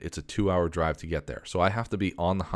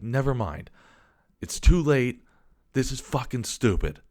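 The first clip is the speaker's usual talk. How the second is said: angry yell; 6 semitones higher than usual. Retold or imitated. Customer storms out on the useless pickup clerk, yelling angrily. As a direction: throw it at him as you storm off. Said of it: "I yelled out".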